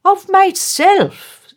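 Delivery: each one shrieks for himself; quarrelling councillors. A narrator's reading. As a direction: councillors quarrelling over the statue. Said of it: "as they quarrelled".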